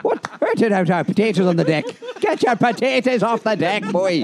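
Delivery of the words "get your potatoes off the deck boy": hammed-up Irish accent, higher and slow; mock Irish accent. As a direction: bark it like a stage Irish parent, slowly and up high. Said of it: "He's mimicking their poor accent".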